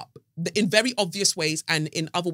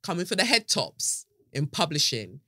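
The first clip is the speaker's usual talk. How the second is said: ordinary talk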